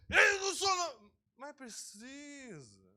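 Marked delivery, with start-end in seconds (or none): 0.1-1.1 high, loud protest; indignant denial. Retold or imitated. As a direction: shout back in denial. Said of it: "Aí você".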